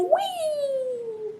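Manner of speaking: long high thin wheee, held out; high drawn-out squeal of glee; gleeful whee. Acted. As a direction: squeal softly like sailing downhill. Cat rides skateboard downhill.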